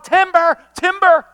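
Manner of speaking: repeated high, loud holler; urgent full-voiced cries; warning shout. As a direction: shout it twice to warn the proud. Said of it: "Why do people shout".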